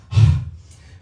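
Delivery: loud, forceful sigh of annoyance; big imitated exhale; exasperated huff. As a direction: huff like a fed-up seatmate. Imitates annoyed seatmate huffing.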